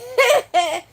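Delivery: sudden high burst of laughter; loud laugh. Real, not acted. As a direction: break out laughing. Laughs at own anecdote.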